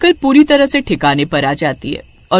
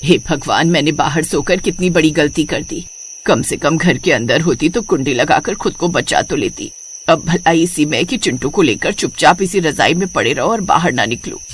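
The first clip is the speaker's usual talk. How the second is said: low, softer voice of regretful thought; worried inner voice; 6 semitones lower, 4 dB quieter than usual. Acted. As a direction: think to yourself, regretting the mistake. (मन में पछताती नीची आवाज़)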